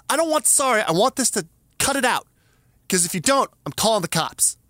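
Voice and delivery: high heated demand fading down; angry threat. Retold or imitated. Threatening to call the cops on the neighbor, heated. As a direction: press him hard, then settle into the threat. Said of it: "now I'm furious and my voice matches".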